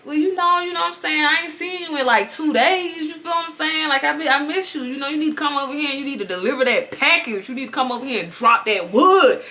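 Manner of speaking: soft sweet-talk, acted out; coaxing. Girl telling Chad she misses him, soft coaxing. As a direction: coax him over, softly and coyly.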